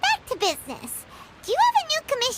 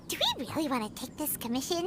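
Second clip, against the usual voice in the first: nervous aside; 8 semitones lower, 8 dB quieter, much faster than usual. Acted. Paimon nervously backs out.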